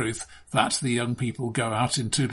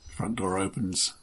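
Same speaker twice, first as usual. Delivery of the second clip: ordinary talk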